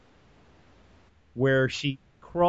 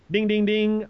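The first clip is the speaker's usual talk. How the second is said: high chanted ring bell; ding it like a fight bell; mock bell; 7 semitones higher than usual. Host marking the fight start like a ring bell. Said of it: "bell imitation".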